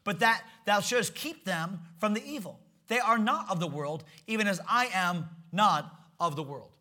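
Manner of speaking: measured, solemn King James reading; scripture reading. Acted. Preacher reading Jesus's prayer to the congregation, solemnly. Reads John 17 aloud.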